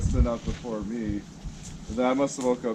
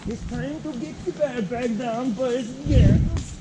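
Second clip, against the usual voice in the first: high, slow singsong Swedish chef; Swedish chef accent; 13 semitones higher, slower than usual. Pretend. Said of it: "You hear my little Swedish chef accent there?".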